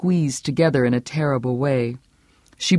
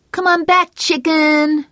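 much higher, louder taunt; calling out; 14 semitones higher, 3 dB louder, slower than usual. Acted. Boy taunting his friend in a snowball fight, calling. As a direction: call a child into the woods. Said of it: "Norm called".

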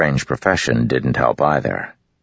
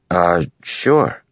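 quickly; 4 semitones higher than usual. A narrator's reading. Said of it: "Cal said quickly".

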